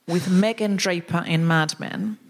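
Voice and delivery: ordinary delivery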